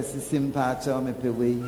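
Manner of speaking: husband gently praising the food; gentle request. Retold or imitated. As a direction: ask her sweetly and quietly. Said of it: "mete ma no sɛ".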